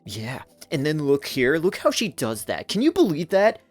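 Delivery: mocking jealous critic. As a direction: sneer it out like a petty know-it-all. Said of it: "they're just watching it more and".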